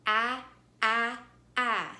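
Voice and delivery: AH said as short A in apple, loud; short A sound. Shown as a demonstration. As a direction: punch out AH as the vowel of cat. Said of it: "when it is saying a short sound".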